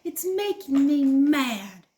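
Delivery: loud, slow mad whine; frustrated child. Acted. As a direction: grumble like a cross toddler. Jilly's frustrated plea.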